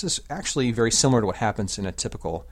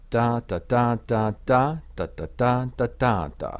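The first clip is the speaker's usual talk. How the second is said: "da" syllables beaten out higher on the accents; rhythmic da-da demo; 3 semitones higher than usual. Shown as a demonstration. Teacher sounds out the metre for listeners, rhythmic da-da demo. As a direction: chant "da, da" in a syncopated metrical beat. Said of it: "altogether, it sounds like this".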